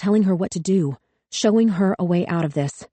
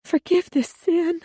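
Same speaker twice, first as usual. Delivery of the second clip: much higher, slow, tearful plea; sobbing prayer; 11 semitones higher, slower than usual. A narrator's reading. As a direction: pray through tears. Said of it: "Missus Pinkman sobbed".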